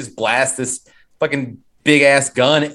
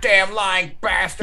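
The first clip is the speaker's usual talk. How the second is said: mock angry accusation; 6 semitones higher than usual. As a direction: ham up a betrayed woman's rage. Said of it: "she's like".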